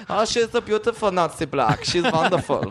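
put-on high, gushing German accent. Teasing the host with his own sketch, mock German accent. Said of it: "doing an impression of your sketch".

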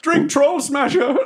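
high, slow slogan yell; shouted plug. Acted. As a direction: holler the drink's name to the crowd. Shouted plug during announcer's speech.

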